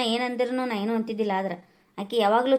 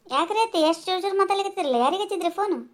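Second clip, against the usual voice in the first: put-on higher voice of the young daughter-in-law; a different, higher woman's voice asking; 8 semitones higher than usual. Daughter-in-law asks mother-in-law.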